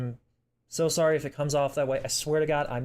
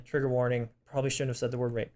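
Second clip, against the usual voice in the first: ordinary talk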